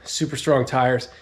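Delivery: ordinary talk, nothing marked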